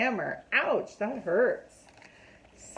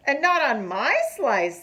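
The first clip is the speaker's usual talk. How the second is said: lightly voiced turtle, a touch higher and louder; Matthew the turtle; 5 semitones higher, 6 dB louder than usual. A narrator's reading. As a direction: stress NOT, a bit higher than the beaver. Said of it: "says Matthew the turtle".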